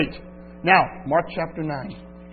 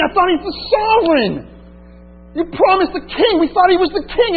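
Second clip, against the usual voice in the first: much higher, dismayed outcry of betrayal; shocked disbelief; 10 semitones higher than usual. Acted. Disciples at the cross demanding answers from God, shocked outcry. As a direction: cry out as if the promise just broke.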